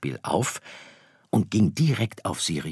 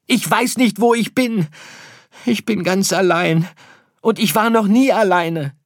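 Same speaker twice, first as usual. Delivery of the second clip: high, loud plaintive calling; lost little star crying out to parents; desperate yelling; 14 semitones higher, 7 dB louder than usual. Acted. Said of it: "brüllte Sirius". (verzweifeltes, hohes Rufen)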